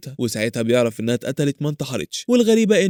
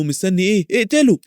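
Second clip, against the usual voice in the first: higher, mildly urgent voice in the head; urgent goading, lightly acted; urging inner voice; 6 semitones higher than usual. A narrator's reading. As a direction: egg him on, rising on the command. Inner voice urges a killing.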